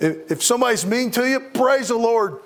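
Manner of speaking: sermon climax, belting each line to the pews; full-force preaching. Acted. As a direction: rouse the congregation to rejoice.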